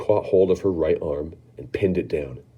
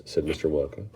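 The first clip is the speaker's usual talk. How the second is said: usual speaking voice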